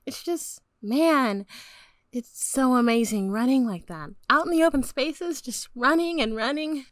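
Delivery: put-on very high girl character voice; wonderstruck enthusiasm. A narrator's reading. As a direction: gush about the joy, high-pitched. Cassie enthuses about running as horse.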